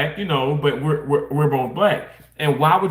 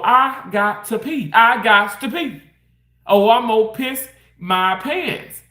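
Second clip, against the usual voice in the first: desperate to pee; 6 semitones higher, slower than usual. Retold or imitated.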